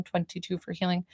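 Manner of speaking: usual speaking voice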